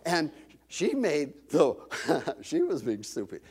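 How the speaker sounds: ordinary talk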